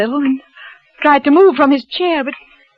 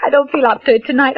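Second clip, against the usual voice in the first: normal talking voice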